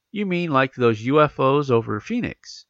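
usual speaking voice

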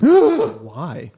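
loud, indignant disbelief; shocked and protesting; outraged why. Real, not acted. Outraged reaction to the verdict.

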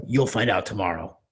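light New York Brooklyn voice; a hint of a Brooklyn guy saying it; Brooklyn accent. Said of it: "New York sort of Brooklyn accent says".

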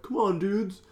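lightly voiced high-pitched dude call; hint of a movie beach boy; beach dude calling friends. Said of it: "he's at the beach with friends".

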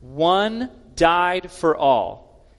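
very slow, each word weighed; slow deliberate reading. Shown as a demonstration. Preacher rereading verse fourteen slowly for the congregation, deliberate. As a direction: read it slowly, letting every word land. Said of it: "I want to read that again slowly".

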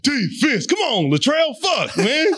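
high, heated cheerleader heckle; mock yelling. Cheerleader to the football players, mock yell. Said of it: "You're yelling at the football players".

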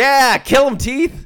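very high, loud egging-on; shouting. Acted. Bystander cheering the killer teeth on, shouting. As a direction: yell encouragement in a hammy high voice. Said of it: "the other guy starts shouting".

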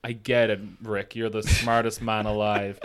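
mocking fanboy. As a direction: mimic a smug fan in a hushed monotone. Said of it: "in the cinema are all like".

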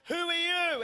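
lightly voiced startled girl; girl's startled echo. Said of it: "And she said to me".

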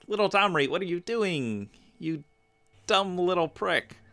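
high-pitched, fretful chiding; playful exasperated telling-off; mock scolding. Mock scolding of a fictional character.

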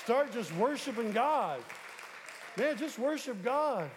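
slow, emphatic preaching. Acted. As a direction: preach it with weight. Preacher exhorting the church.

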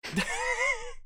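sudden high laugh; high burst of laughter. Real, not acted. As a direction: break out laughing. Laughing at own joke.

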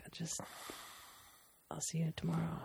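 very soft, retold whisper; whispered goodbye. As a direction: whisper a faint, halting goodbye. Retold weak goodbye to the child.

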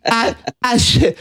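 high, slow stammering voice; stammering Elephant Boy. Retold or imitated. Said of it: "I like when he starts stammering".

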